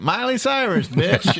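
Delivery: high-pitched imitation of a friend; teasing high-voiced quote; mock outburst. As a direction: mimic your friend blurting it, way up high. Mimicking friend's outburst in retelling.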